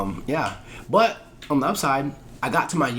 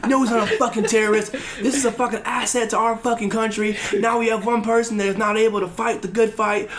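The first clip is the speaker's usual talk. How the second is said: higher, mock-furious chewing out; cussed out; 8 semitones higher than usual. Imitating the officer cussing him out over the injured recruit, mock furious. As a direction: imitate an officer tearing into you, pitched up. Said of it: "I'm getting cussed out".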